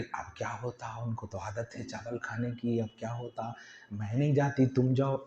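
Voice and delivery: a hushed worried aside; hushed whispering. Retold or imitated. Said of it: "अंदर गुटपुट कर रही". (धीमी फुसफुसाहट में)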